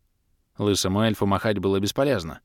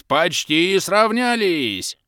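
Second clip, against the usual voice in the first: slow, high, loud call, "сравнялись" stretched long; drawn-out call; 10 semitones higher, 4 dB louder, much slower than usual. A narrator's reading. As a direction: drag "сравнялись" out like a commentator at the finish. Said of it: "всё комментирующая". (протяжный, растянутый выкрик «сравнялись»)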